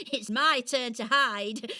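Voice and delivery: ordinary delivery, nothing marked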